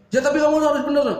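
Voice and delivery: angry customer. Acted. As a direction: insist hotly like an irate caller.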